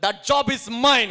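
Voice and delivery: slow, emphatic and loud claim; loud declaration. Shown as a demonstration. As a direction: proclaim it slowly with force. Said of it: "ఏం చేయాలి అప్పుడు?". (నెమ్మదిగా, గట్టిగా ప్రకటన)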